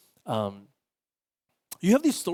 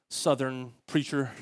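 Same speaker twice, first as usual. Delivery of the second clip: ordinary talk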